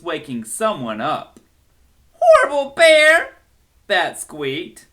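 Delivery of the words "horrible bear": loud, high furious yell; angry shout. Acted. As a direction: shout it out at the bear. Bear practices the shout.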